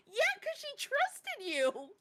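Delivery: high, slow, mock earnest. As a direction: draw it out up high. Retold quote.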